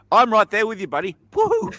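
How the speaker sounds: playful hype ending in a high woohoo; mock encouragement. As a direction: cheer a buddy on in play. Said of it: "It's like encouragement".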